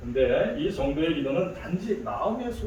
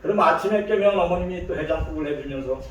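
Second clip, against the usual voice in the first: ordinary delivery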